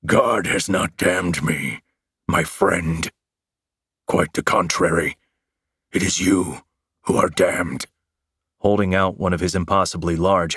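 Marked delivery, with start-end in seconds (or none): 0.1-7.9 menacing monster growl, deliberately paced. A narrator's reading. Monster turning the curse on the hero, menacing growl. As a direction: pronounce judgment as a growling monster.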